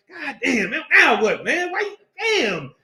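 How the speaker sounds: high, drawn-out mock frustration; hammed-up wail of annoyance; David's exasperated protest. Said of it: "he like, he probably like".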